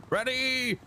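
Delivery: high, hammed-up charge call; mock battle cry. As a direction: imitate an officer announcing the charge. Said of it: "they would go".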